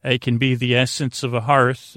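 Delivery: normal talking voice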